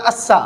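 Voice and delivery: अस्सा with a fine, light hissed s and open "aa"; thin whistling s. Shown as a demonstration. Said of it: "महीन आवाज में बोलना है".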